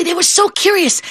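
ordinary delivery, nothing marked

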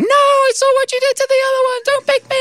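very high squeaky plea; pleading worm voice. Pretend. The next worm begging not to be picked, squeaky plea. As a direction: beg like a tiny terrified worm.

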